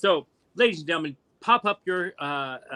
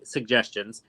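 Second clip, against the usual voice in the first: normal talking voice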